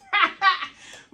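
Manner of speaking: loud laugh. Real, not acted. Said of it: "I'm very theatrical".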